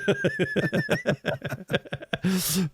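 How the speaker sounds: high wheezing laughter; laughing hard. Real, not acted. Friend laughing at the security story. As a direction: crack up at the joke.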